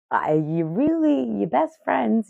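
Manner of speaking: usual speaking voice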